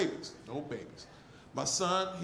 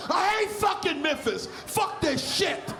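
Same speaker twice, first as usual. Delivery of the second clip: angry cursing; 7 semitones higher, slower than usual. Acted. Driver cursing Memphis after hitting a pothole, angry high shout. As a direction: explode at the pothole.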